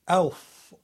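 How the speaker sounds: loud, high, prim little curse; a prim gentleman's mild swear, loud and high; polite swear. Retold or imitated. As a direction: curse like a well-bred gentleman. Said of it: "It sounded so polite".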